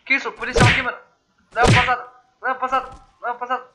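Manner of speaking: high, loud urgent callouts; shouting orders over battle. Real, not acted. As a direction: bark callouts over the gunfire. Calling out during police shootout. (gritando ordens no combate)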